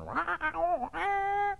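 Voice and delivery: high-pitched drawn-out "rah"; silly high wordless wail; mock trumpet-voice blare. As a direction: imitate a trumpet blare with your voice, badly. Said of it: "like a guy opened his voice, went".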